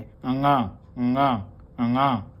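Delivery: गंगा with a nasal ng, the g softened. Shown as a demonstration. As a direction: say गंगा through the nose. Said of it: "थोड़ा नास्की sound देके बोलें". (गंगा को नाक से बोलना)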